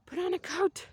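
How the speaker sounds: a mock stern mom. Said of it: "And mom's like".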